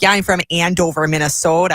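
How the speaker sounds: put-on thick Minnesota accent; hammed-up Minnesota accent. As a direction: lay the Minnesota vowels on thick. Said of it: "I'd lay it on real thick".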